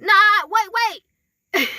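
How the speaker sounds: high-pitched mock panic, repeated objections; mad protest, imitated. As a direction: act out thwarted demons calling a halt. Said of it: "Now they mad. They like".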